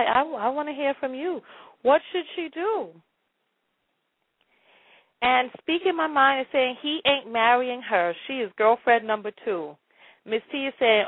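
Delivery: ordinary delivery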